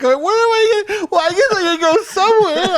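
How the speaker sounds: very high, loud squeal; hammed-up helpless wail in falsetto; whiny protest. Mocking his own protest to police, whiny squeal.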